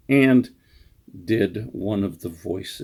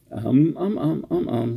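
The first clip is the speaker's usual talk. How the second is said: mock filler words, getting them out of the way; deliberate string of ums; 5 semitones higher than usual. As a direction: rattle off ums on purpose, higher in pitch. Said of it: "Let's just get those out of the way".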